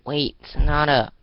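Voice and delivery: loud call after her. Acted. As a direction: shout to stop her running off. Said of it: "Arya called out".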